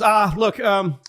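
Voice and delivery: high, loud, pleading and frazzled; exasperated staffer. Retold or imitated. Overwhelmed staffer pleads with the caller, high and loud. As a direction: beg the caller to just go away. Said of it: "He goes".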